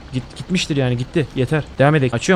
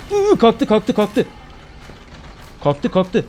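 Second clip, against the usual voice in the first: scared whimper; 6 semitones higher, much slower than usual. Real, not acted. Enemy rising again in horror game.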